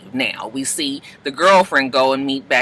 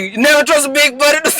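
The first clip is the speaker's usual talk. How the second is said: high, fast, shouted sassy line; hammed-up sassy shout; sassy Polly; 12 semitones higher, 4 dB louder, faster than usual. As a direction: belt it out like a diva. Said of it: "Polly was like".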